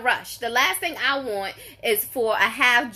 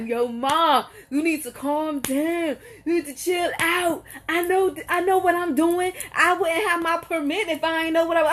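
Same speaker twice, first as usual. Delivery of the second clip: lightly voiced teen son, a bit higher; imitating her son; 5 semitones higher than usual. Son telling his mom to calm down, lightly mocked. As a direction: play the cocky teen telling mom to chill. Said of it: "And he's like".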